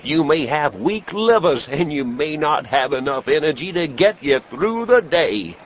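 high, booming medicine show pitch; snake-oil salesman. Acted. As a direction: sell it hard to the crowd like a huckster. Traveling professor sells his elixir.

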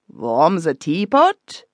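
put-on slow, scoffing German accent, V for W in warm. The Herr Rat mocks the English tea secret in a German accent. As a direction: say vorm ze teapot like a German scoffer. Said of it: "interrupted the Herr Rat".

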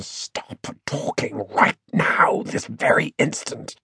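intense whispered inner scream; screaming inwardly. A narrator's reading. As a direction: hiss it slowly in a desperate whisper. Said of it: "Finn's brain was screaming at him".

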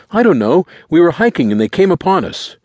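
high, loud, defensive answer; high-pitched boy's voice; frantic teenage boy. A narrator's reading. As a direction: explain it anxiously as a teenager. Boy explains the attack.

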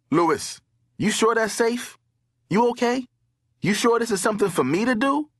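high-pitched worried questions; apprehensive calling. A narrator's reading. Brandon calls into the dark pipe after his friend, apprehensive.

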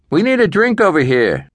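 high-pitched call across the tables. A narrator's reading. Sal to a waitress several tables away, calling out. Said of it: "called to a waitress several tables over".

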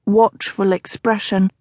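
usual speaking voice

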